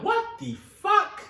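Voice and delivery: drawn-out shocked what the fuck; stunned. Retold or imitated. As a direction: gasp it in disbelief. Family finds the cat.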